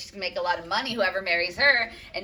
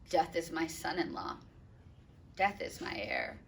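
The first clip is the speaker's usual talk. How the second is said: soft, mournful reading of the line; hushed, heavy lament; quiet grief; 7 dB quieter than usual. Acted. Reading Capulet's lines.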